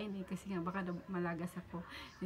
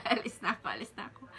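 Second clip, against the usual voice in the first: laughing; 2 semitones higher, 3 dB louder, faster than usual. Retold or imitated. Fleeing while laughing.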